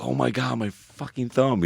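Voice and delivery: high, drawn-out retold agony; mock pained exclamation. Telling the host about an injured thumb, mock pained exclamation.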